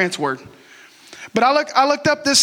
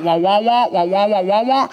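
Charlie Brown adult wah. Retold or imitated. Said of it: "like Charlie Brown".

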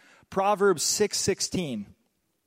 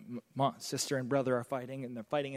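ordinary talk

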